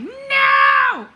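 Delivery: sudden high, loud "No!"; inner outcry. Pretend. Body protesting the wrong pronunciation, high loud outcry. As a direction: shriek "No!" in protest. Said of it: "every being of, of my body is like".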